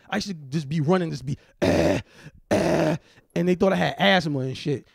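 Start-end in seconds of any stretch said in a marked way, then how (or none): 1.6-3.0 louder, hammed-up breathless cough; mock wheezing cough. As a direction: cough like a winded fat kid. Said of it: "I had that fat kid cough".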